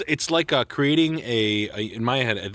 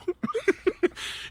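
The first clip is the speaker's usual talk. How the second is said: high laugh burst; 4 dB quieter than usual. Real, not acted. Joking about autocorrect.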